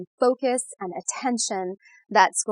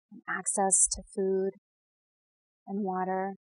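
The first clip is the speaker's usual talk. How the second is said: guided meditation; 4 dB quieter than usual. Real, not acted. Guide leading listeners to settle and breathe, soothing meditation voice. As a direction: ease the listener gently into calm, unhurried.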